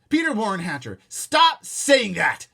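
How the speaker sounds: high, loud, very slow stern holler; full-name scolding holler; hollering mom. Acted. Mother hollering at her son, angry. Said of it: "Mom hollered".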